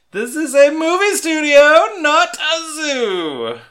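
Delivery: very high, loud, slowly stretched zoo; haughty society aunt. Retold or imitated. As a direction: shriek it like an outraged grande dame. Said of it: "gives it the perfect delivery".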